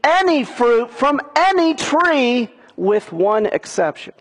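"any fruit from any tree" drawn out slowly, high; stress on "any". Shown as a demonstration. Preacher stressing God's words to the congregation. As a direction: lean hard on each "any". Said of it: "God is emphasizing".

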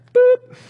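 high, loud "boop"; mock hang-up sound. Parent hanging up on a caller, a sharp "boop". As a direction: pop the hang-up noise.

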